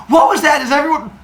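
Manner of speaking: loud, high-pitched startled cry; hammed-up alarm from Father Sky; alarmed shout. Father Sky startled by shaking ground.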